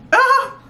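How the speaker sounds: high happy squeal with a laugh; delighted squeal. Real, not acted. Fan sees the first single, squealing. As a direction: squeal at the record.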